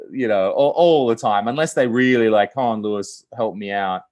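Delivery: usual speaking voice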